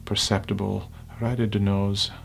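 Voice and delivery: unhurried, hushed guidance, phrase by phrase; guided meditation. Acted.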